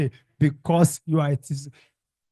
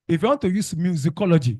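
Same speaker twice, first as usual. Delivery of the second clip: ordinary talk, nothing marked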